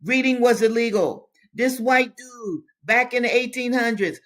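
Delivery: loud, high, indignant insistence; impassioned exhortation. Real, not acted. Reader telling listeners reading was illegal, indignant insistence. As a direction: proclaim it high and forcefully.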